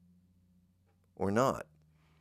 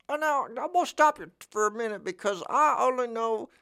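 high put-on voice, not his own; 13 semitones higher than usual. Comic cutting himself off in a high silly voice. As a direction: interrupt himself in a higher silly voice. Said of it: "can I do it in my voice?".

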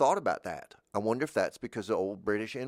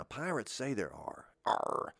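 usual speaking voice

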